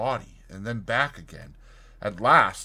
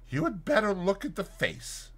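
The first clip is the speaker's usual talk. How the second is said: higher, held back and uneasy; constrained; 8 semitones higher, 4 dB quieter than usual. Acted. McFarlane urging his friend to look: constrained, higher. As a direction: say it as if the words stick. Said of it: "in tones of some constraint".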